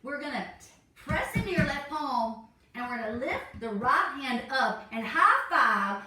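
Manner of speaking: ordinary delivery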